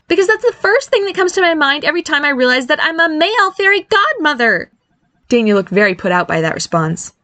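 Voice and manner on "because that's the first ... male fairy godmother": high-pitched exasperated quipping; sarcastic quip. Acted. Barry laments being a fairy godmother, quipping. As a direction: pitch it high, complaining of his lot. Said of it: "Barry quipped".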